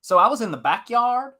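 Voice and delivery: Southern accent. Shown as a demonstration. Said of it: "key to doing a Southern accent".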